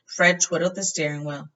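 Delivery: plain reading voice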